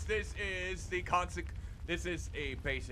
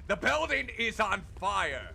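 alarmed; 5 semitones higher, 6 dB louder than usual. Real, not acted. Shocked by burning nightmare.

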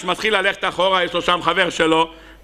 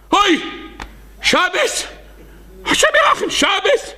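panicked wail; 13 semitones higher than usual. Acted. Man discovering he broke Shabbat, high panicked wail. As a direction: wail in horror at breaking Shabbat.

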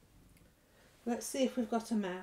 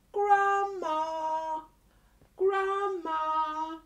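mock calling out; 5 semitones higher, 6 dB louder, much slower than usual. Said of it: "So he shouted for his grandma".

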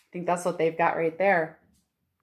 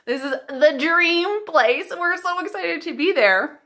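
excited pretend arrival; 7 semitones higher, 4 dB louder than usual.

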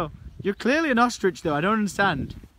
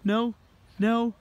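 ordinary talk

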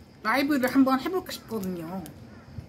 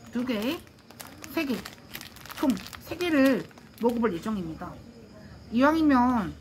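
muffled behind mask; through a face mask. Real, not acted. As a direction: mask covering mouth. Seen 0: Cloth face mask covers mouth and nose throughout; pulled down in references.

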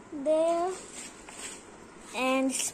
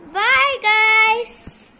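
louder, higher bye guys; loud cheerful goodbye; 4 semitones higher, 9 dB louder than usual. Real, not acted. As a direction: shout a happy goodbye to viewers. Sign-off to viewers.

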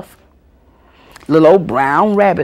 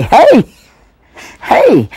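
loud, high answering hey; hollering back; 7 semitones higher, 9 dB louder than usual. Retold or imitated. Child yelling back at her granddaddy, loud. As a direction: shout it back cheerfully.

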